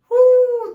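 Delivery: loud high celebratory whoop. Acted. Preacher whooping for the feast, loud and high. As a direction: whoop like a party guest. Cheer for the party.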